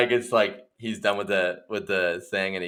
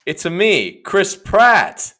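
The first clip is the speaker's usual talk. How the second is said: loud, raised-pitch Mario catchphrase; showy audition catchphrase, building louder; Chris Pratt auditioning; 11 semitones higher, 9 dB louder, much slower than usual. Acted. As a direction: announce yourself big, punching the name. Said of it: "then Chris Pratt comes in".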